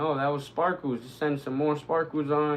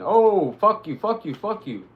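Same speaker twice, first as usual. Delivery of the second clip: higher, louder furious outburst; angry cursing; 7 semitones higher, 8 dB louder than usual. Real, not acted. Streamer cursing at the enemy who got him, angry outburst. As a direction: swear at him in real frustration.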